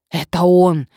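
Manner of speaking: loud, urgent exclamation; exclaiming. A narrator's reading. Nadezhda recognises the man who drove up, exclaiming loudly. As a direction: burst out on realising who it was. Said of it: "воскликнула Надежда".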